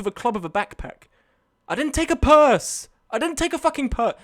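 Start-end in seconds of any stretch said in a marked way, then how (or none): 1.7-4.1 high, exasperated protest; indignant denial. Real, not acted. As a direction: protest it loudly, pitch shooting up. Indignantly denies chat's purse teasing.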